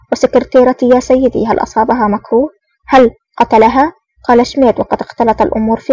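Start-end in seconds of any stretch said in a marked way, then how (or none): none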